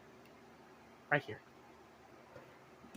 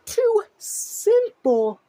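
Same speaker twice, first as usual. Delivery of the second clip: "two simple" pulled slow, higher and louder; "two simple" hammered out slowly, pitched up; stressed; 13 semitones higher, 5 dB louder, much slower than usual. Real, not acted. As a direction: stretch out "two simple" in disbelief. Ranting about two mistakes.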